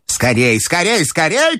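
put-on high, urgent old-lady command; high, insistent grandmother. A narrator's reading. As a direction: hurry the bearers along imperiously. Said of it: "командовала бабушка".

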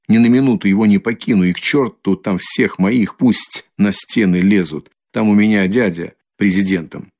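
ordinary delivery, nothing marked

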